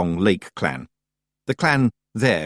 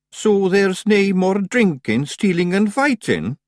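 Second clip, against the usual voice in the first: high, slow Scots worry; a Feagle dreading marriage, slow high Scots; slow dismayed Scots pictsie; 11 semitones higher, 4 dB louder, slower than usual. Acted. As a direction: drag it out in horror.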